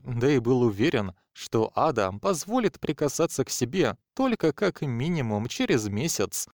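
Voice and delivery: usual speaking voice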